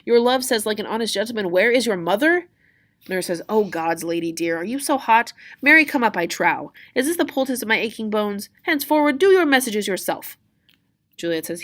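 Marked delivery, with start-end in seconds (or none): 0.1-2.5 high, loud, incredulous echo; exasperated mocking repeat; indignant Juliet. Juliet throws nurse's words back. 3.5-10.4 loud, high, huffy rebuke; acted indignant outburst; scolding nurse. Nurse scolds hot-tempered Juliet.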